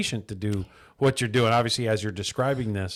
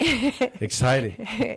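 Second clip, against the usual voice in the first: drawn-out, much higher, amused delivery; amused, laughing; 13 semitones higher, much slower than usual. Real, not acted. Host joking with deputy about graveyard shift, amused. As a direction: stretch it out high through a laugh.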